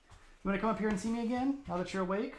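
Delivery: high, quick coaxing voice; puppy talk. Real, not acted. Owner calling the just-woken puppy, high coaxing talk.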